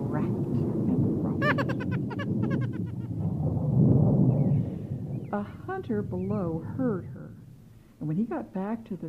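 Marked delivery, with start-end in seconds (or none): 1.5-5.3 high, breathy witch cackle; nasty laugh. Acted. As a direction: cackle wickedly from the sky. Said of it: "she broke into a nasty laugh".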